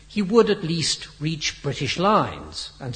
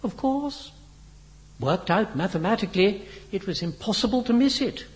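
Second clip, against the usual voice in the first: breezy, higher-pitched nonchalance; casual; 8 semitones higher than usual. A narrator's reading. Henry explains his mathematics to Biggles, casual. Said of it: "replied Henry casually".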